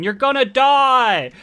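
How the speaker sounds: loud, high, drawn-out taunt; retold taunting shout. Kid brother yelling through the shop window at his nervous sister, taunting shout. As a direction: stretch it out loud to torment her.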